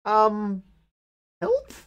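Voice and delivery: high, bewildered voice; stunned squeak. Real, not acted. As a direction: ask in a dazed squeak. Player stunned at bad end.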